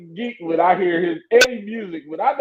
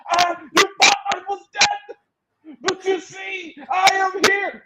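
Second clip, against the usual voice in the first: hammed-up, high-pitched movie comeback speech; imitated grand challenge in a raised voice; returning hero's proclamation; 10 semitones higher, slower than usual. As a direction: declaim it slowly as a mock triumphant return. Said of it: "then he came back against Killmonger".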